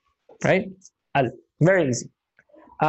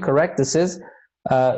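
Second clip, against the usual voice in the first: usual speaking voice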